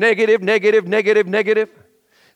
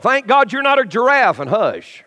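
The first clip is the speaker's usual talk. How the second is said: ordinary talk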